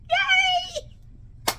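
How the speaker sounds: high happy whoop; excited cheer. Real, not acted. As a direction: cheer out in celebration. Celebrating new veneers.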